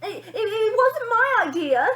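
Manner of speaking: high, loud stammering fright; frightened splutter. A narrator's reading. Scared father blaming the mother, high and loud. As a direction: stammer out the excuse. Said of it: "spluttered a frightened father".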